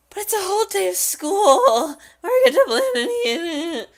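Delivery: high, loud cartoon whine; high-pitched character voice; protesting game character. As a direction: squeal it in outraged protest.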